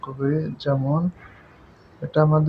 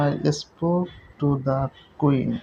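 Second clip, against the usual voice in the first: "I spoke to the queen" said very slowly, with "the" said as a stressed "di"; "the" hit as an emphatic "di"; much slower than usual. Shown as a demonstration. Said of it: "নির্দিষ্ট করে এবং জোর দেওয়া বোঝাচ্ছে".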